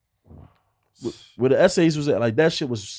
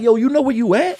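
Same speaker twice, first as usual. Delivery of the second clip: high loud baffled cop; incredulous cop; 11 semitones higher, 8 dB louder than usual. Retold or imitated. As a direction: scold him in disbelief.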